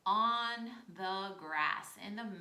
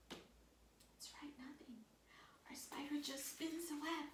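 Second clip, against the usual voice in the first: soft whisper, barely any voice; 4 semitones higher, 9 dB quieter than usual. Acted. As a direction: hush it like the silent spider. Quiet spider, whispered to kids.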